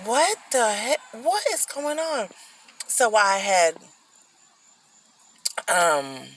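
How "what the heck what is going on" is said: higher-pitched, acted-out shock; baffled inner reaction, retold; wide-eyed disbelief. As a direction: react stunned, pitch lifted. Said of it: "my eyes got big. I was like".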